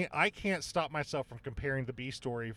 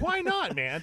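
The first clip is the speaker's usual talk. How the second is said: excited; 12 semitones higher, 8 dB louder, slower than usual. Real, not acted. Excited retort about eighties.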